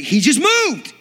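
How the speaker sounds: high, slow, loud shocked cry; astonished shout. Retold or imitated. Preacher realising dead man moved, shocked loud cry. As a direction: yell it out in disbelief.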